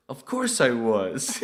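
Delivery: high, loud, slow mock reply; mock producer. Producer admitting he was reading too, hammed-up high and slow. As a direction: ham up the producer's answer. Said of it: "And he goes".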